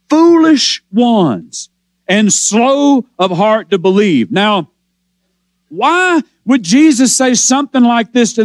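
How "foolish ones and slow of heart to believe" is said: drawn out, dwelling on each word; slow, deliberate voicing of Jesus' rebuke. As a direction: stretch the rebuke out slowly.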